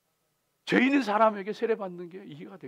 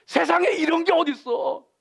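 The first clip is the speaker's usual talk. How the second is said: much higher, louder raging complaint; angry outcry; 11 semitones higher, 8 dB louder than usual. Retold or imitated. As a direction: rage at the heavens in disbelief. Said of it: "그 사람이 막 분노할 때". (하나님께 울분을 터뜨리며 높고 크게)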